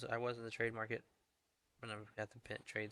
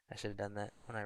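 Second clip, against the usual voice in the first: usual speaking voice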